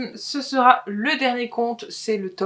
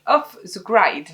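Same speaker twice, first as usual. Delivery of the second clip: slow, careful English accent on the title; a deliberate English accent, each word placed; 5 dB louder, slower than usual. Shown as a demonstration. Announcing a Formula One novel title to viewers, in an English accent. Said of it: "avec mon accent anglais".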